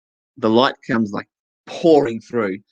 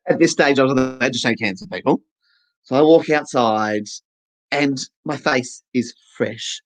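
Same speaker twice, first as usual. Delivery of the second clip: ordinary talk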